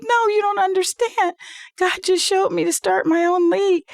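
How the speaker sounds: high, urgent acted protest; excited insistence. Acts herself insisting to husband.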